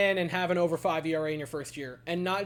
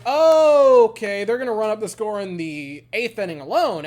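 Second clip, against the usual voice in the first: sarcastic realization; 3 semitones higher, 10 dB louder than usual. Retold or imitated. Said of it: "you're like".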